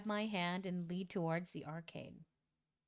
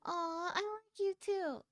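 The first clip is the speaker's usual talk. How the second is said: put-on higher, sweet girl's reply; high girly voice; 9 semitones higher than usual. Crushy answers the confession in a high cooing voice. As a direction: coo it like a shy girl. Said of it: "Crushy goes".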